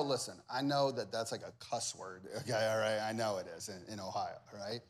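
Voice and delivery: low, soft aside; quiet, low-pitched admission; confiding aside. Real, not acted. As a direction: drop it down like admitting a dirty word. Confiding aside about rival state.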